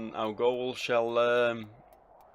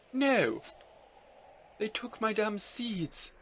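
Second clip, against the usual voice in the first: distressed outburst; 10 semitones higher, much slower than usual. Acted. Character robbed of seeds, high-pitched distressed protest. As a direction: protest slowly and high-pitched after the first cry.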